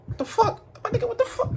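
baffled laugh. Real, not acted. Streamer cracks up at a strange game animation, high laughing disbelief. Baffled by drunk friend's moves.

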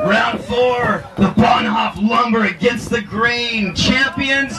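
high, drawn-out champion announcement; big ringmaster-style introduction; event announcer. Real, not acted. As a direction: announce the winners to the crowd, stretching the words.

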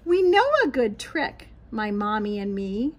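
ordinary talk, nothing marked

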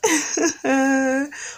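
short high soft laugh; a brief amused laugh. Real, not acted. Reader laughs after story.

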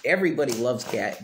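usual speaking voice

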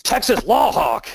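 high-pitched, crazed Texas Law Hawk cry; crazy voice. Shown as a demonstration. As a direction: put on a wild, high crazy voice. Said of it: "You gotta say it with the crazy voice".

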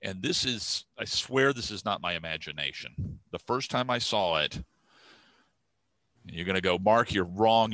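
usual speaking voice

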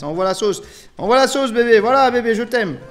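loud, high burst of excitement; excited gamer cheering on the attack; hyped up. Real, not acted. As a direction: egg it on loudly, getting carried away. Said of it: "on va se calmer".

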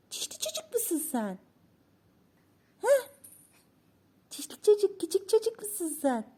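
put-on very high, sing-song cooing at a baby; high-pitched baby talk to a giggling grandson. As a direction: coo up high like you're delighting an infant.

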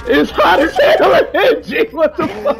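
laughing shout. Real, not acted.

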